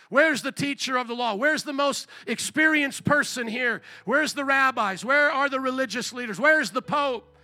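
preaching challenge. Acted. Preaches rhetorical challenge, building.

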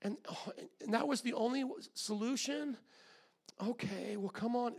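a hint of a mild, indulgent God; God voiced quiet and easy; softly sarcastic mock God, barely voiced. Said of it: "listening to your story going".

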